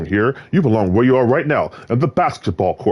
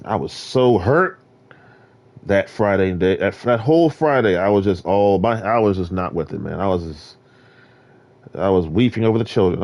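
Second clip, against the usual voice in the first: ordinary delivery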